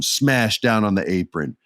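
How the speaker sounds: usual speaking voice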